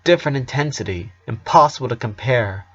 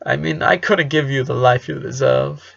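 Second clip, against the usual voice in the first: plain reading voice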